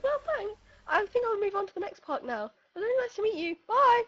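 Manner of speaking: very high, loud, breezy imitation; cheery goodbye. Stranger leaving his exhausted companion, high mock-cheery farewell. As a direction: imitate an untired runner signing off brightly.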